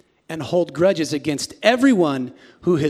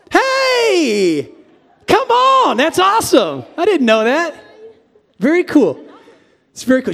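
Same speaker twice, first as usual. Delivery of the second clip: high loud delighted shout; real excited hollering; excited cheer; 11 semitones higher, 5 dB louder than usual. Real, not acted. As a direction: cheer the crowd on. Excited cheer for leap babies.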